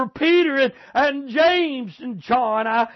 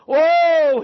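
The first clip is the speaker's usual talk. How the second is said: preacher's cry; 5 semitones higher, 5 dB louder than usual. Acted.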